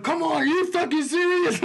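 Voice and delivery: high-pitched mock outrage; imitating angry mom. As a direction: mimic an exasperated mom at the TV. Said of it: "mad as shit watching political stuff".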